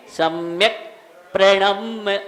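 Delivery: सम्यक, a clear break, प्रणम with held m; pause between words. Shown as a demonstration. Teacher showing where to pause, pause between words. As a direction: stop on सम्यक, then a separate प्रणम. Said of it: "यहाँ रुक के".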